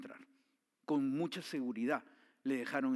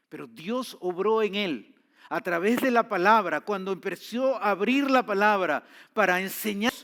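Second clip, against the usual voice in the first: loud, higher, full-force preaching; 6 semitones higher, 10 dB louder, slower than usual. Acted. Preacher exhorting listeners God transforms, full-force preaching. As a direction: drive the message home to the congregation.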